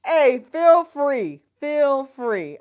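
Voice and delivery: loud, slow, drawn-out welcome; open, booming feel free; excited invite. Real, not acted. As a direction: beam it out to the viewers. Inviting viewers.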